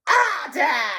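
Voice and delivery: piercing, high character voice; loud, high-pitched jeer; shriek. A narrator's reading. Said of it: "shrieked a voice from the corner".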